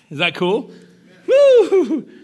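rising question into a loud joyful whoop; excited whoop. Real, not acted. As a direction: fire the room up with a shout. Rallying crowd mid-sermon.

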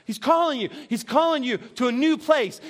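normal talking voice

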